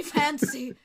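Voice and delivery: high, slowing melodramatic plea; pleading lady. Acted. Creature begging Fancy as guards approach, melodramatic plea. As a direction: plead slowly, clinging to a lover. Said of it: "she says".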